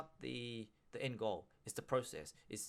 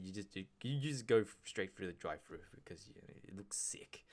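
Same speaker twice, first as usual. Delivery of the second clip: hushed cool aside; 5 semitones lower, 3 dB quieter than usual. Acted. Conspiratorial aside on drive-through.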